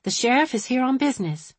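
put-on gently but firmly correct a child. A narrator's reading. Woman telling little girl the sheriff is busy, firm. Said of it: "Violet said firmly".

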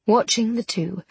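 usual speaking voice

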